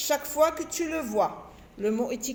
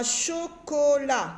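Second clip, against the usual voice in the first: "chocolat" drawn out slowly, each syllable held; 2 semitones higher, much slower than usual. Shown as a demonstration. Teacher showing children three syllables, "chocolat" syllable by syllable. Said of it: "j'ai trois syllabes".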